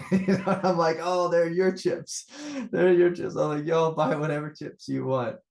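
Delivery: high, loud, tickled retelling; laughing. Real, not acted. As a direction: crack up while delivering the punchline. Cracking up telling his punchline.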